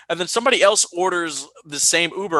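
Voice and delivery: ordinary delivery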